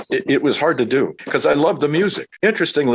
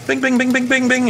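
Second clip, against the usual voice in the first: high ringing bing bing; imitating a wire bell; 14 semitones higher than usual. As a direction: ring it out like a teletype bell. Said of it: "it would ring".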